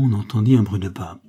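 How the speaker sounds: ordinary delivery, nothing marked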